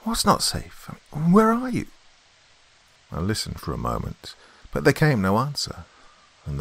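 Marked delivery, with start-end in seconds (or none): 0.0-1.9 high-pitched baffled questioning; amazed, voice pitched right up; astonished. A narrator's reading. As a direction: call out in growing bewilderment. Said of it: "growing more and more astonished".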